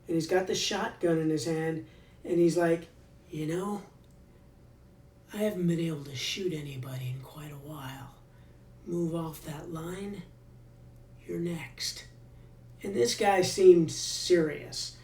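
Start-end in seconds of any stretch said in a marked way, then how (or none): 3.3-12.1 menacing quiet threat. Retold or imitated. Guard threatens prisoner with shotgun.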